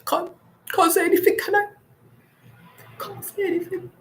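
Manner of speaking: high, mocking put-on voice; mock reply. Answering husband about Las Vegas, high mocking voice. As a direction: pitch it way up, teasing. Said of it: "and I was like".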